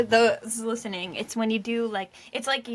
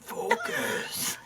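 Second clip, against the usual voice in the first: imitating demon voice; 4 dB quieter, much slower than usual. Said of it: "That was my demon teacher voice".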